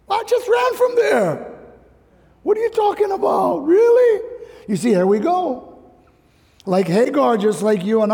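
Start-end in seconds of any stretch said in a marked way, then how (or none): 0.1-4.2 much higher, outraged disbelief rising to a loud cry on "Really"; incredulous protest. Acted. Hagar protesting to the angel of the Lord, incredulous. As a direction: play Hagar, stunned and indignant.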